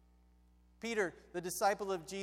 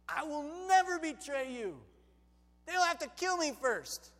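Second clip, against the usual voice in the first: raised-pitch, emphatic pledge; vowing Peter; 6 semitones higher, 4 dB louder than usual. Acted. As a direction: swear loyalty with a touch of bravado. Peter swears loyalty to Jesus.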